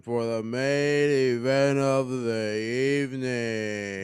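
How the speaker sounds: slow motion. Pretend. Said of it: "Why do you sound like you're in slow motion?".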